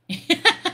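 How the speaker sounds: high-pitched cackling giggle in bursts; hyena giggle. Acted. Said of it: "giggling like a hyena".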